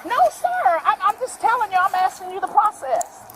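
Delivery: high, tense and insistent; pushing back, near tears of frustration; upset protest. Real, not acted. Woman protests arrest.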